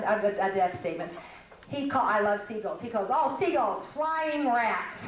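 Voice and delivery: normal talking voice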